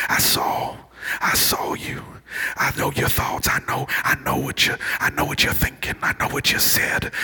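intense accusing whisper; hushed, knowing accusation; enemy's accusing voice. Acted. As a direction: whisper it like a tempter who knows secrets. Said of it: "You hear that voice".

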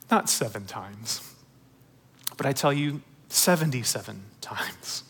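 Jesus tenderly answering Peter, low and hushed. Retold or imitated. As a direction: gently correct him with tenderness. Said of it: "the compassion, the tenderness in Jesus' words".

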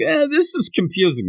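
high, puzzled little voice; confused Matsuda. Retold or imitated. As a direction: play the baffled sidekick lightly.

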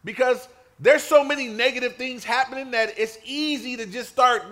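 ordinary talk

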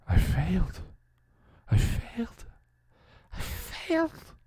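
rising into a high, voiceless whisper; high, crumbling self-reproach; anguished despair. A narrator's reading. Shocked hero breaking down over failure.

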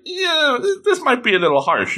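high-pitched, hesitant hedging; reluctant misgiving. Acted. Judges second-guessing turning her down, reluctant. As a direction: start high on a doubtful yeah, then ease down.

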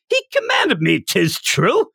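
high, sing-song stage Irish; Irish accent. Retold or imitated. Power accusing Denji in a high Irish accent. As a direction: put on a thick Irish lilt, high. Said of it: "it's pure Irish".

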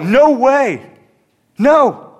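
high, loud flat refusal as Peter; Peter refusing. Retold or imitated. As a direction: voice Peter pushing Jesus away. Peter refuses Jesus washing.

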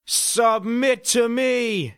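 loud, slow, high Bronx bellow; channelling the Bronx. A narrator's reading. Neighbour's son demands surrender again, slow high Bronx shout. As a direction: draw out the command in mock Bronx. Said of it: "channeling the Bronx".

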